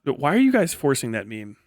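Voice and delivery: ordinary delivery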